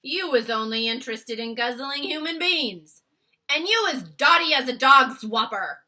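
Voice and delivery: loud giant jeer; crying out. Acted. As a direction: crow it out scornfully. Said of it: "cried the blood bottler".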